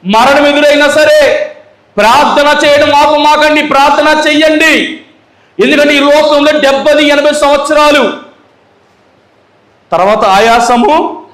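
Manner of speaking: pleading with the church to keep praying; fervent preaching. Acted. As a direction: preach it high to the congregation. Preaching at full force.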